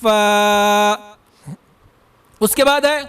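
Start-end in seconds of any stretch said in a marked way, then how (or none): 0.0-0.9 فا made from the moist part of the lips; correct reading. Shown as a demonstration. Teacher giving the students the correct فا. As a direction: model a clean فا. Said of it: "وہاں سے ہی ادا کیا جائے".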